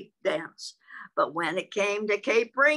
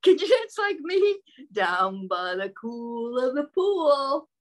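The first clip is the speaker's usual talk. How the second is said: gleeful frog calling out; frog shouting whee; 6 semitones higher, slower than usual. Acted. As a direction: cheer like an overjoyed frog. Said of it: "Frog cried".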